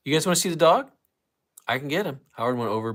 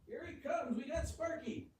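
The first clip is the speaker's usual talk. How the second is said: high, faint announcement from a distance; distant, high sing-out; far off; 15 dB quieter than usual. Real, not acted. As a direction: announce the arrival from across the house. Returning with dog, away from the mic.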